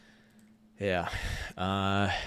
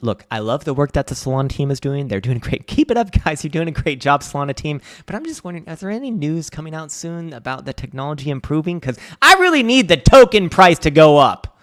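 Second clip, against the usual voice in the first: higher, louder hammed-up plea; mock pleading fan; 6 semitones higher, 8 dB louder than usual. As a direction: beg the devs in a sarcastic fan voice. Mock pleading to Solana team.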